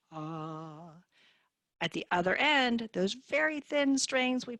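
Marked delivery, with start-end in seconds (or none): 0.1-0.9 soft, low sustained ah; low pitch. Shown as a demonstration. Therapist likens vocal cords to fat strings, low-pitched ah. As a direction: hold a quiet low note. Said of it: "low pitch".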